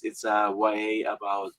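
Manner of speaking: ordinary delivery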